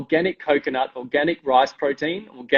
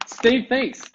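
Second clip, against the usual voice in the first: higher pitched, laughing through it; 8 semitones higher than usual. Real, not acted. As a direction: tease while laughing. Teasing a colleague.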